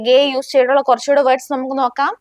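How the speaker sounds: ordinary delivery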